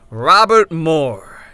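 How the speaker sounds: slow, ringing pronouncement; proclaiming. A narrator's reading. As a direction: call out the name like a sentence passed. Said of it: "he proclaimed".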